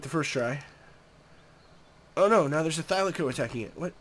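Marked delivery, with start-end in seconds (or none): none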